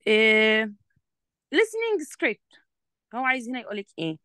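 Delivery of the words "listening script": listening script drawn out slowly, much higher; slow announcing. Shown as a demonstration. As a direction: announce the title slowly to the student. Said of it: "teacher reading the title". (إعلان العنوان ببطء)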